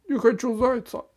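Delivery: put-on high, loud child's blurt; a small boy snapping out his refusal. A narrator's reading. Boy at the dentist blurts his refusal. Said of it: "и быстро буркнул".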